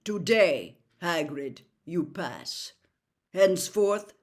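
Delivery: stern centaur and gruff Hagrid; lightly voiced centaur and Hagrid; voiced characters. A narrator's reading. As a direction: read the figures with weight.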